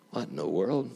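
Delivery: hushed, bewildered mutter; acted soft-voiced bafflement; puzzled aside. Startled fisherman mutters to himself.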